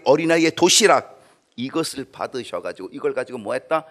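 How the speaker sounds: ordinary talk, nothing marked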